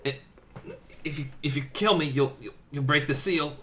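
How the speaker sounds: fast, stammering, paralyzed plea; stuttering. Acted. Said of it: "who stutters because you can stutter when you're paralyzed".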